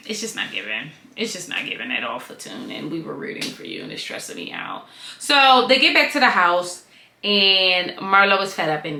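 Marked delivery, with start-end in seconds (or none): 0.1-4.9 low, hushed and breathy, almost whispered; hushed letdown. Retold or imitated. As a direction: drop to a disappointed near-whisper. Voicing the producers' disappointment.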